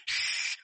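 voiceless shush to oneself; long hushing hiss; hushing shh. Acted. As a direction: cut yourself off with a shush. Acting David hushing himself.

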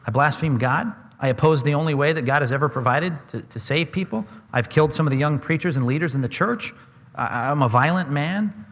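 ordinary delivery

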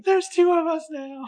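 very high, drawn-out put-on voice; singsong squeal as the surprise twin; mock twin reveal. Voicing the imagined twin reveal.

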